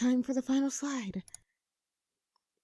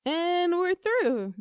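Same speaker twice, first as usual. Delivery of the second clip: drawn-out relief; 9 semitones higher, 5 dB louder, much slower than usual. Acted. Relieved to finish the slides.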